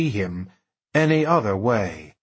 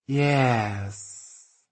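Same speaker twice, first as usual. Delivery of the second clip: slow drawl; 3 dB quieter than usual. Acted. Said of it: "Truman said slowly".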